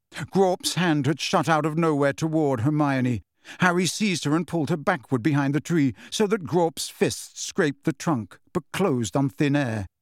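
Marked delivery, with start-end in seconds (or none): none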